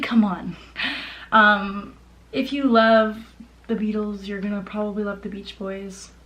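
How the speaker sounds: ordinary talk, nothing marked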